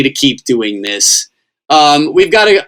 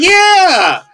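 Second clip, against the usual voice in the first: high-pitched mock-happy gushing, laughing; hammed-up, much higher grateful cheer; overjoyed relief; 12 semitones higher than usual. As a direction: play up giddy relief at being spared. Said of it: "I'm so happy".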